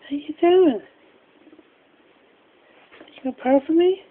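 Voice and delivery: soft murmured pet talk; soft cooing. Real, not acted. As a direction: coo softly so the bird stays calm.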